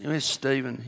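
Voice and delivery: ordinary delivery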